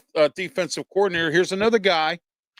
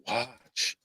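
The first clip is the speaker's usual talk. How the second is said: creepy TV announcer; slower than usual. Retold or imitated. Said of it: "the announcer on Halloween three when he says".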